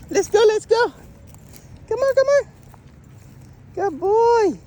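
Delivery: high, loud praise for the dog to keep moving; excited dog call. Real, not acted. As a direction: cheer the dog on to follow.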